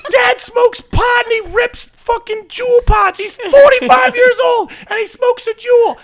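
screaming high and loud, enraged; furious yelling. Acted. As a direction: scream at him like a furious wife. Improv mom screaming.

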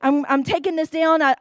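ordinary delivery

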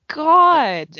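loud, high exclamation; exasperated outburst. Real, not acted. Host enraged by the article, exasperated outburst. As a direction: burst out in exasperation.

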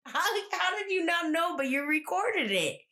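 hammed-up incredulity; mock disbelief. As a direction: retell his baffled challenge. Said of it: "He's like".

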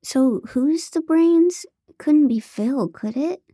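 high, slow dopey feigned stupidity; fake-dumb question, high and slow; playing dumb. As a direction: ask it as stupidly as possible. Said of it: "I said as stupidly as possible".

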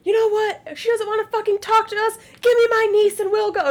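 husband making fun of her absent friend, high mock outrage; mock outraged husband. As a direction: ham up the husband's complaint, pitched high. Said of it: "But Carl's like".

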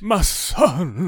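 loud, slow, overjoyed cry; doting father. Retold or imitated. Father reunited with his son, loud and slow. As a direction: call out to your long-lost son. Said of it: "and he goes".

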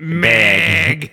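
loud, mocking overdone Chicago vowel; hammed-up Chicago accent, pushed loud; exaggerated Chicago accent. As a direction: hit it hard in a mock Chicago accent. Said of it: "Nobody's saying it that hard".

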